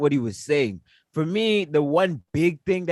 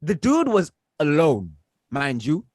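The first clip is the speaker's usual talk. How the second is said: ordinary talk, nothing marked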